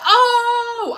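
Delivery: high, loud laughing Oh; crowd gasp. Retold or imitated. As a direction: yelp it like the whole crowd reacting. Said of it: "everybody was like".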